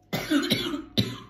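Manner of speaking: short sharp unvoiced cough; dry cough. Real, not acted. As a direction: cough once, dry and loud. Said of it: "je tousse un petit peu".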